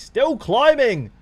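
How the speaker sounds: high, loud and slow outraged cry; real frustration shouted out; exasperated shout. Real, not acted. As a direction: wail it at the screen in disbelief. Frustrated at a player he benched.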